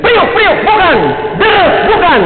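imitating a calo's high route call; tout yelling. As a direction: shout out the routes like a calo. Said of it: "dia teriak".

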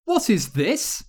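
high-pitched alarmed question; storybook hero caught off guard; startled. A narrator's reading. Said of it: "The boy who lived was startled".

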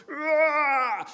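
loud high war cry mid-dive; blood-curdling cry. Retold or imitated. Boy diving out at the shooter, blood-curdling cry. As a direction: let out a would-be blood-curdling roar. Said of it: "blood-curdling cry".

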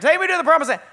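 begging God, pushing it up. Retold or imitated.

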